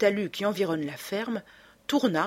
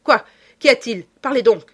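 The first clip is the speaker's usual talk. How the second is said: urgent demand; 5 semitones higher, 10 dB louder than usual. A narrator's reading. Lupin urgently questions Césarine.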